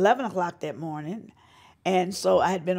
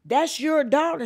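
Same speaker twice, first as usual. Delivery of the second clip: high, louder disbelieving question; astonished; 9 semitones higher, 6 dB louder than usual. Retold or imitated. The fan club woman learns the singer is her daughter, astonished. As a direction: sound floored that it's her child. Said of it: "And she said".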